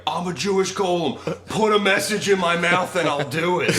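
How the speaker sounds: put-on excited drunk ranting. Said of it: "he was like".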